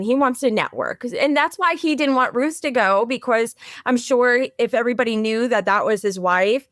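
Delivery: ordinary talk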